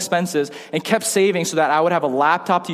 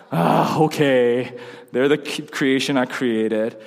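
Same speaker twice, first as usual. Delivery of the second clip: hammed-up resigned sigh, slowing to a crawl; mock reluctance; 4 semitones lower, slower than usual. Preacher imagining God in heaven, mock reluctant. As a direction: drag it out, low and grudging.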